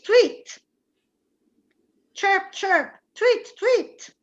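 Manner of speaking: playful bird call; bird tweeting. Shown as a demonstration. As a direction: chirp like a small bird. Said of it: "or tweet".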